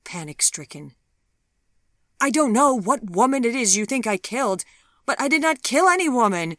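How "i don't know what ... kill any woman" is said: fast, loud, high protest; heated insistence. Acted. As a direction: push back hard, rushing the words. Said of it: "he insisted heatedly".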